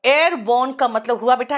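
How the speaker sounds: ordinary delivery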